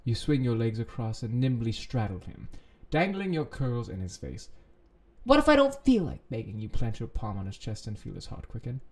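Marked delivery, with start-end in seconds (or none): 5.2-6.6 high, loud challenge; defiant retort. Acted. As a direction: throw it back defiantly. Acted defiant reply in story.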